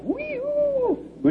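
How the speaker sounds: very high, soft whistled elk bugle; piercing falsetto wee-oo; elk call. Retold or imitated. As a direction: imitate a distant elk's whistle. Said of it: "I could hear the elk".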